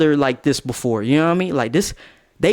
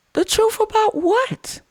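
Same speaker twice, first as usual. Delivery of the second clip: squeaky falsetto incredulous question; high-pitched disbelief. Acted. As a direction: jump way up high, demanding an answer. Acted girlfriend's alarmed demand to know.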